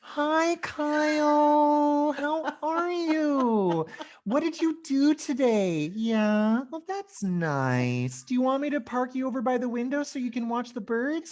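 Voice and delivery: very high, slow, syrupy singsong; patronizing talk to a patient. Acted. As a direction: coo at them as if gravely ill. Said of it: "Like, I'm a cancer patient".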